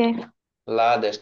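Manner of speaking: "Bangladesh" slowly parted into syllables; slow counting-out of "Bangladesh"; split into syllables. Shown as a demonstration. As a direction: draw out লা, then দেশ in "Bangladesh". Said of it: "এটাকে আমরা কি syllable বলবো".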